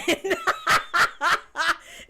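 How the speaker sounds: big, loud, high burst of laughter; loud real laughter. Real, not acted. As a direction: let the laugh explode out. Laughs at own joke.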